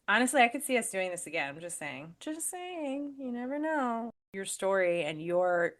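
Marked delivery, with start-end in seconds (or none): 2.2-4.1 higher, drawn-out teasing lilt; playful high-pitched hint; teasing singsong. Real, not acted. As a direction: sing it out coyly, pitched well up. Teasing singsong repeat of remark.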